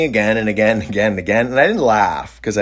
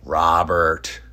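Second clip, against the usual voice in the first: low, tight seething name; through gritted teeth; 4 semitones lower than usual. Retold or imitated. Angry cook addresses him in group therapy, through gritted teeth. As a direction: imitate a man seething through clenched teeth. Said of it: "He gritted his teeth and goes".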